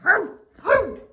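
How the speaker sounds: high, huffy humph, humph, humph; angry bird humphs. Acted. Bunga bird yelling at the squirrels, high huffing. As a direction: huff it out like a cross bird. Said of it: "yelled a bunga bird".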